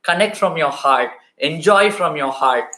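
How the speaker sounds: low, earnest closing appeal; heartfelt sincerity. Real, not acted. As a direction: drop the voice low and speak from the heart.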